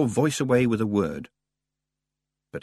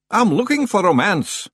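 loud, high-pitched rough stranger; rumbling stranger; 11 semitones higher, 5 dB louder than usual. A narrator's reading. Tall stranger demanding at the library door, loud and rumbling. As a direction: announce it loudly as the odd figure. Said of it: "it rumbled".

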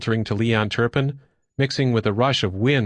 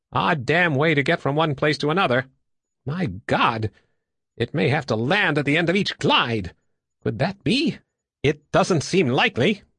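higher, animated disbelief; doubting investigator; 5 semitones higher than usual. Acted. Stanley doubting the gliding craft, higher and puzzled. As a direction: puzzle it out with lifted pitch.

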